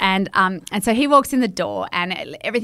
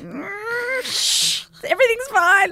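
high fake calm; slower than usual. Hushing kids, faking calm.

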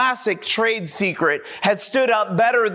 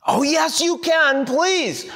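high whiny plea; high-pitched begging; 10 semitones higher, slower than usual. Acted. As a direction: beg in a high whine. Young man keeps begging.

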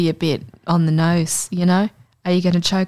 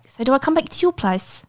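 imitating a Kiwi date, higher pitched; mock New Zealand accent, pitched up in a question; 6 semitones higher than usual. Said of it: "He's like".

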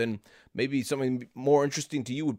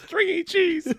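squeaky; 3 dB louder, slower than usual. Acted. Comic squeal about cheese.

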